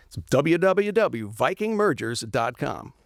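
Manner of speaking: slow, booming radio announcer. Shown as a demonstration. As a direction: read the web address like a radio ad. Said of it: "let's do the radio voice one more time".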